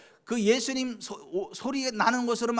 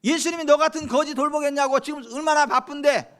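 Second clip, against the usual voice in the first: higher scolding rebuke; scolding crowd; 6 semitones higher, 3 dB louder than usual. Retold or imitated. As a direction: scold the beggar to shut up. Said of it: "많은 사람들이 막 꾸짖습니다".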